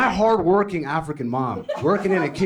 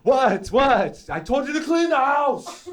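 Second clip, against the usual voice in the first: furious mom act; 10 semitones higher, slower than usual. Acted. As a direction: yell at the son as an outraged mother. Angry mom confronting her son.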